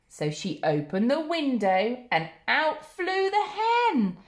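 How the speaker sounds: ordinary delivery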